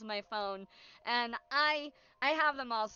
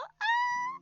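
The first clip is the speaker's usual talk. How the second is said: high squeak laugh; 4 dB louder than usual. Real, not acted. Squeaky laugh after silly bit.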